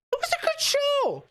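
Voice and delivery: sudden high squeaky insistence; emphatic squeal. Acted. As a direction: squeak it out in protest. Insists the show was good.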